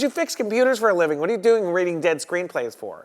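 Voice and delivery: imitating a riled agent, high and loud; indignant yell, pitched right up. Agent tears into the IT guy who read the script, yelling. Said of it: "sets off easily and yells a lot".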